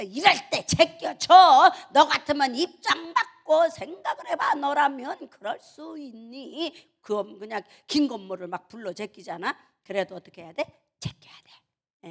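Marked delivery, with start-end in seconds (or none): 0.0-6.7 mock protest. Mock challenge to the congregation. 11.0-12.1 slow hushed whisper; near-voiceless, drawn-out answer; whispered aside. Real, not acted. Whispered answer to own question.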